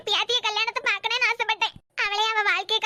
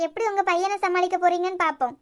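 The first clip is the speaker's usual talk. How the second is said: ordinary talk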